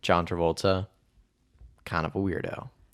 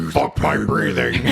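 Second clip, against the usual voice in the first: mock mother in labor; 3 semitones lower, 4 dB louder, slower than usual. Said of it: "she's like".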